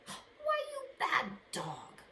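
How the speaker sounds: high, slow playful scold; mock scolding. Miss Hester teasing the dog, playful scold. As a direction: tease the dog gently. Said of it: "she said".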